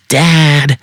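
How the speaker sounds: loud, high, sharp call; yell. A narrator's reading. Woman turning to the old man at the register, yelling. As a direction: yell for your father impatiently. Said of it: "turns to the old man and yells".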